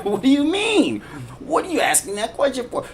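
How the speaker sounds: high, incredulous imitation of the offended listener; mock disbelief. A believer asking the questioner why he even asks, high mock disbelief. People challenged on their beliefs.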